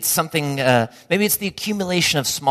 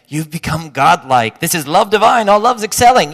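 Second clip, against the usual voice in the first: louder, higher, hammed-up hymn-like exaltation; mock grand praise; 3 semitones higher, 10 dB louder than usual. Host overpraising a guest's candle, hammed-up grandeur. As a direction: overblown worship of a candle. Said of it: "you're not saying".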